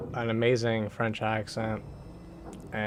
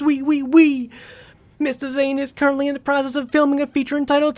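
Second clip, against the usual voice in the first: much higher, louder, hammed-up French accent; mock French accent; 12 semitones higher, 6 dB louder than usual. Streamer keeps reading for chat, French accent building. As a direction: go over the top, a cartoon Frenchman. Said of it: "wrap with a French accent".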